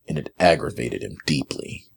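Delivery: plain reading voice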